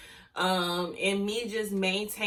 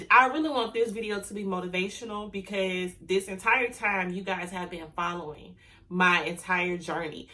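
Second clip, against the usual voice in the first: ordinary talk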